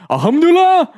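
high, loud mock war cry; shouted battle-cry impression. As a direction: yell it like a video game fighter. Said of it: "all my impressions like that are".

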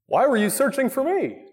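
put-on a touch of twelve-year-old sarcasm; lightly sarcastic question; rhetorical question. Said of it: "That's a rhetorical question".

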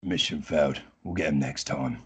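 a mild video game mission voice; game announcer impression. Shown as a demonstration. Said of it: "Can you do it again? Just the, um, mission failed bit".

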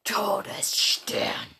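slow, drawn-out whispered name; eerie slow whisper. Acted. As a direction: breathe the name out slowly. Sudden enemy appears, name called.

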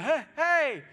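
mock startled calls, high and loud; startled Paul. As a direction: call out in sudden alarm, playfully. Acts Paul shaking off the snake.

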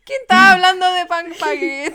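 loud and high, slow, through laughter; laughing question. Real, not acted. To a friend who changed the subject, a loud laughing question. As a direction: crack up as you ask it. Friend's baguette tangent amuses her.

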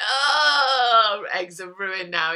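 loud hammed-up "Oh", then slow lament; mock dismay. Retelling a friend's remark about eggs, with mock dismay. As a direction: play up the loss of eggs for laughs. Reacting to chicken's period phrase.

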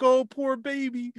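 high, slow mock sympathy; mock cooing. Sarah Essen comforting Gordon, high slow mock coo. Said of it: "Sarah Essen's like".

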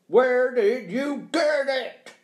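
slow, loud, high-pitched interrogation; stern demand. Acted. Accuser demands the money's source, loud and stern. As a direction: press hard, stretching each word.

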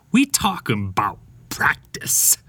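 slow impersonation dropping into a whispery hush; Allen Iverson. Acted. Host quoting an athlete on practice, slow hushed impersonation. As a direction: draw it out slowly, letting the voice fall away. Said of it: "My best Allen Iverson impersonation".

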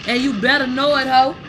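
loud, high mockery with a laugh; loud mocking. Real, not acted. Vlogger laughing at the man saying mamita, loud mocking. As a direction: hoot at him loudly.

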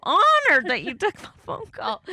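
high, gushing Southern flattery; Southern accent. Retold or imitated. Thanking her date as if royalty, gushing Southern accent. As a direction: play honored in a hammed-up Southern accent. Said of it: "In, like, a Southern accent".

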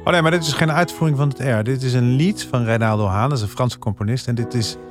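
ordinary delivery

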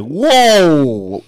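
loud, drawn-out falling whoa; mock shock. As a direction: exclaim it long, swooping down from high. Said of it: "Rashida Jones is like".

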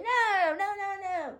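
high, soft crying protest; mock crying. Being taken away, imitated sobbing no. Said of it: "She like".

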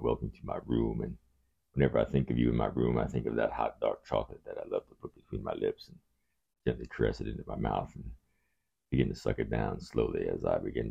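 very deep, soft love-man monologue. Acted.

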